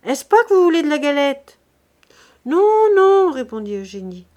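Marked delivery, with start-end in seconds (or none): 0.0-1.6 loud question called through a window; high, carrying shout to someone inside; calling out. A narrator's reading. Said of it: "cria-t-elle par la croisée". 2.5-3.3 high, loud drawn-out call; very raised, carrying voice; calling back. A narrator's reading.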